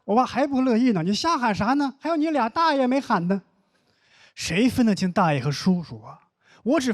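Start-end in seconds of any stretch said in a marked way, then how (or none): 1.0-3.4 high-pitched fatherly telling-off; mock scolding dad. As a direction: scold the child, pitch jumping up. Dad scolds child over greeting. (模仿爸爸提高音调训孩子)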